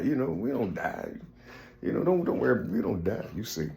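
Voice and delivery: acted soft, dismissive aside; hushed reassurance. Book believers tell the woman not to worry, hushed reassurance. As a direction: play someone quietly talking her out of it. Voicing others hushing the woman.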